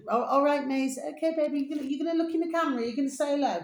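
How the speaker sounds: high, coaxing a pet toward the camera; sing-song to your dog; coaxing pet talk. Real, not acted.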